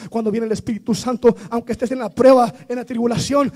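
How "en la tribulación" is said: usual speaking voice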